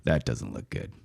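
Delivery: low, hushed commentary fading to a whisper; golf announcer. Pretend. Mock-announcing Steve stuck behind a tree, hushed golf announcer. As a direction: murmur the bad lie to viewers. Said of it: "Could you say something golf announcer-like".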